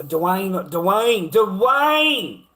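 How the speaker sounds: building from a call to a full yell; hollering across the house for Dwayne. Real, not acted. Calling out to someone off-screen.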